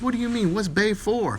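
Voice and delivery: high, puzzled question; confused husband. Acted. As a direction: ask it baffled.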